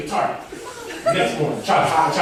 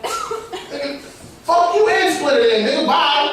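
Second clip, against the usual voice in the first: high, laughing mock anger; fake-furious brush-off, laughing; mock mad; 11 semitones higher, slower than usual. High guy mock-cursing the turtles, laughing anger.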